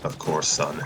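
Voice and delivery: gentle. Acted. Fred promises to stay with his son, low and soft. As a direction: soothe him to sleep. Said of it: "Fred replied gently".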